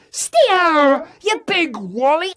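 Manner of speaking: high, loud exasperated yell; shouting. Acted. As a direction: yell the insult at him. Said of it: "shouts Harry Bo".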